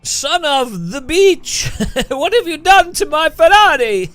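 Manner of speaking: loud, high, hammed-up Italian accent with laughs; over-the-top Italian accent. Furious Italian car builder shouting at the player, hammed-up Italian accent. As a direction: play an outraged Italian car maker, Italian accent. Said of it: "it was literally a perfect Italian accent".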